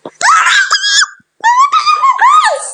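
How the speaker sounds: very high, drawn-out screaming laugh; stretched-out high shriek of shock. Real, not acted. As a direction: shriek half laughing, half crying. Shocked discovery during game.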